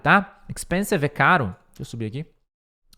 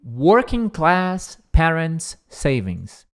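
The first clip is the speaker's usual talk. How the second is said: slow, each word given weight; slow careful reading; 3 dB louder, much slower than usual. Shown as a demonstration. Teacher reading the phrase slowly. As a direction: read the phrase slowly for students. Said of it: "Primeiro essa parte aqui".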